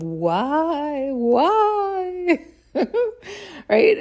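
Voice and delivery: high, loud laughing mock "why"; mocking whine. Mocking endless excuses.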